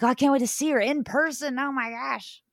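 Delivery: acted giddy anticipation; excited fan. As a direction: gush like a starstruck fan.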